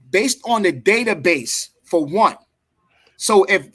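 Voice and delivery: usual speaking voice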